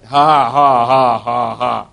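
low, loud forced ha-ha, tailing off; imitated dry laugh. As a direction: throw a deliberate laugh back at the devil. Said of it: "He said the laughter sounded very dry".

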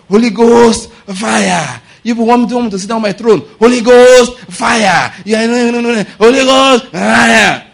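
loud, high fire-prayer cries; mock prayer warrior yelling at enemies; shouting prayer. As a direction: bellow it like a warfare prayer.

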